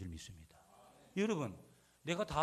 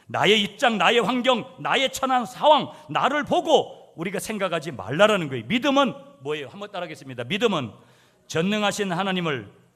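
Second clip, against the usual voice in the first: loud, high, insistent sermon delivery; preaching at full force; 7 semitones higher, 15 dB louder than usual. Acted. Pastor exhorting his congregation, preaching at full force. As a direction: drive it home to the pews.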